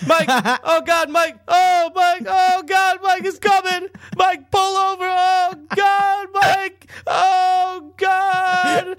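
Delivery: very high, loud desperate shouting; panicked yelling. Retold or imitated. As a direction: shriek like it's an emergency. Dad panicking, voiced in story.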